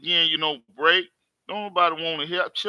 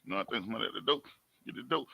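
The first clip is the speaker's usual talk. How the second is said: low and much softer, half to himself; hushed aside; 8 semitones lower, 12 dB quieter, much faster than usual. Real, not acted. Vlogger repeating to himself about the door, hushed and low.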